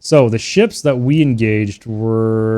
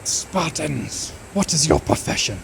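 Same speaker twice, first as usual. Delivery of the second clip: mock British war cry in a British accent; a light British accent, quoting the film; 4 semitones higher, 7 dB quieter, slower than usual. Quoting a movie line to chat in a British accent. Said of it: "In a British accent".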